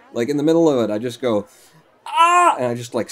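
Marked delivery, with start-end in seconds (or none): 2.1-2.6 high, loud mock scream, reined in; held-back scream. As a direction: scream as if from sudden pain, without full force. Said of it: "I won't even scream as loud".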